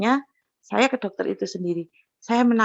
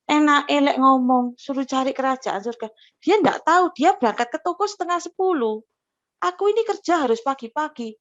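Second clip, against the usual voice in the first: indignant grumbling; 5 semitones higher than usual. Retold or imitated. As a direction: protest hotly, like you're still arguing back. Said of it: "Saya masih ngengkel".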